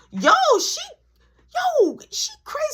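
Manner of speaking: very high, squealed disbelief; incredulous exclamation. Retold or imitated. Teller reacts to her calling back nonstop, incredulous. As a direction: let the voice leap up in disbelief.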